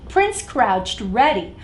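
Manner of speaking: plain reading voice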